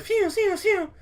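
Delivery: high whistled bullet whizzing past; imitated bullet whiz. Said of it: "There was whizzing back".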